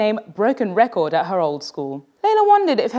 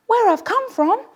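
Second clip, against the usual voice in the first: puzzled surprise; 12 semitones higher than usual. A narrator's reading. Layla puzzled by remark.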